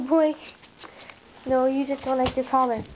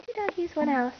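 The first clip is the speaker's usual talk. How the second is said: ordinary talk, nothing marked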